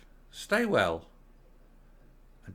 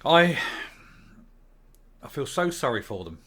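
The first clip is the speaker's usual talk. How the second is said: ordinary delivery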